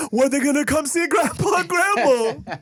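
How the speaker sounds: high laughing parent voice; mock pleading parent. Parent begging to see grandkids, high and laughing. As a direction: whine it like a grandparent-to-be.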